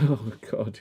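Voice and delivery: ordinary talk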